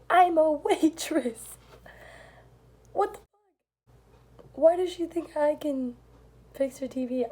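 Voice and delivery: high, slow, disbelieving cry; incredulous. Retold or imitated. Waitress protesting to the old lady, incredulous. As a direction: stretch it out in total disbelief.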